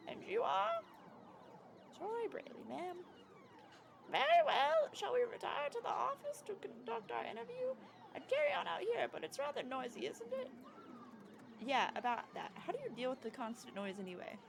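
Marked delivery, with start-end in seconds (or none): 4.1-10.5 put-on high, quavery old lady. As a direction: play a sweet old lady, pitched up. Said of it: "I tried to do an old lady voice".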